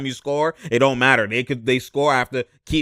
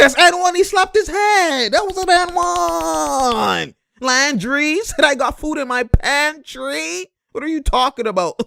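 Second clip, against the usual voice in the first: much higher, slow, drawn-out disbelief; excited outrage; 12 semitones higher, slower than usual. Real, not acted. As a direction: stretch it out in amazed protest. Outraged at the missed foul.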